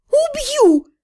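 high-pitched threat; angry hiss. A narrator's reading. Heroine threatening her teasing friend, high angry hiss. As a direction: throw out a furious threat, high and tight. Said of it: "прошипела я".

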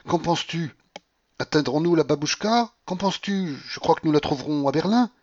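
high, excited planning; feverish eagerness. A narrator's reading. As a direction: ask and answer eagerly. Delirious Paulina plans departure eagerly.

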